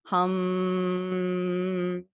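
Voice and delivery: a long steady hum on the exhale. Shown as a demonstration. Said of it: "like hum".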